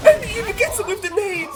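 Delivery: high, laughing disbelief; excited gasping call. Real, not acted. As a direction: gasp and shout it out. Gasp at a play.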